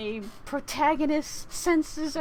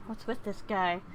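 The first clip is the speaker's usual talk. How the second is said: normal talking voice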